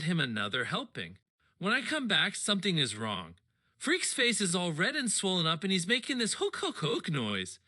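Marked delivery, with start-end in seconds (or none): none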